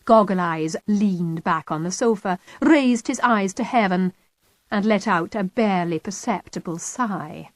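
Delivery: plain reading voice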